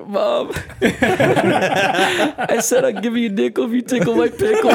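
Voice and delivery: very high, loud wail through laughter; mock bawling. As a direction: blurt out a confession to mom in fake sobs. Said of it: "And I'm crying, and I'm like".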